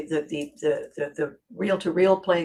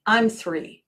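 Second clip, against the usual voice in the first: usual speaking voice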